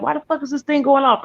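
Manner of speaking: high, baffled mock outrage; startled annoyance. Woken by the smoke alarm, high baffled outrage. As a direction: act out being woken by the alarm. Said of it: "I'm like".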